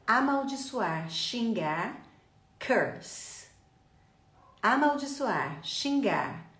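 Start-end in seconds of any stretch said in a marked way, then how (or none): 2.6-3.5 "curse" with er as in her; lower, isolated "curse" with a hissed ending; repeat-after-me model. Shown as a demonstration. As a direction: model "curse" carefully, central vowel. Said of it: "vai repetir comigo agora".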